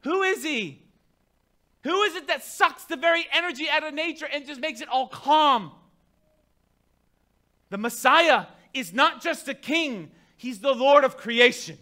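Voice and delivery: loud, high sermon climax; preaching at full force. Acted.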